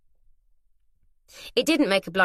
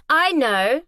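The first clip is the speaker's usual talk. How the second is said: bright raised call, eager and proud; eager shout; 7 semitones higher, 6 dB louder than usual. A narrator's reading. Girl eagerly shouting a guess at her friends. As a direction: blurt it like solving a maths problem. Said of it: "Arabella shouted eagerly".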